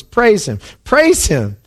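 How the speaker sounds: high, exultant proclamation; voice from the throne. Acted. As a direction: call for praise, then slow down for emphasis. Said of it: "a voice breaks out from the throne and says".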